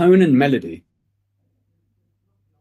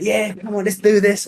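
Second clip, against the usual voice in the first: much higher, revved-up cheer; pumped with the lads; 8 semitones higher than usual. Retold or imitated. Guy out with his friends hyping the game, pumped and high. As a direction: hype it up like you're with your mates. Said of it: "from you being like".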